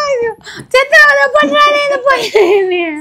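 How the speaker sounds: mock wailing lament.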